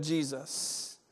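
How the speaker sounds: soft, slow, settled Jesus; quiet slow Jesus. Shown as a demonstration. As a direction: let Jesus land quietly and slowly. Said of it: "the name that we are to be shouting".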